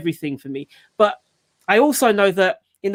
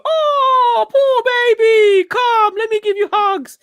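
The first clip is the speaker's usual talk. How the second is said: high, louder, slow weepy cooing; mock weepy mummy; 14 semitones higher, 4 dB louder, slower than usual. Dad mocking the pitying reaction he held back, high and weepy. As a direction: pity the fallen baby, overdone. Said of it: "whether or not I would go".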